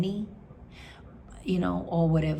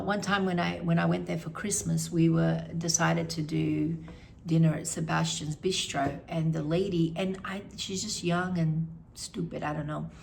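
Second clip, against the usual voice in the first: usual speaking voice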